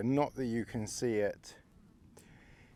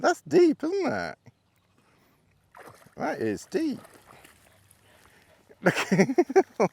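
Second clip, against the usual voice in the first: surprised; 8 dB louder than usual. Real, not acted. Reacting to dog in deep water.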